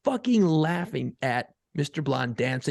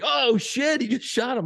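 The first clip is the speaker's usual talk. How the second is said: high-pitched retold exclamation; imitating amused astonishment; 7 semitones higher than usual. Dad imitating his laughing son at the shooting, astonished. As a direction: act out a kid thrilled by a sudden twist. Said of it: "he's laughing".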